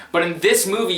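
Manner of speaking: normal talking voice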